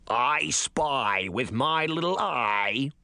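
put-on slow, gurgling frog-girl voice; gurgling swamp creature. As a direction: gurgle it out like a hungry frog monster. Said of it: "the frog girl gurgled".

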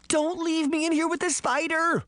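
put-on high, pleading little girl; begging. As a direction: beg like a scared child. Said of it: "Daphne begged".

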